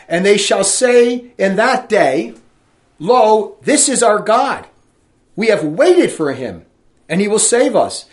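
loud, slow preaching read; scripture reading. Acted. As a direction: proclaim the verse to the congregation. Preacher reads scripture aloud.

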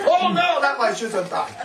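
high, laughing, hammed-up indignation; mock horror. Mocking a proud person who can never be wrong. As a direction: play the proud man aghast at any flaw.

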